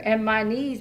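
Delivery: ordinary talk, nothing marked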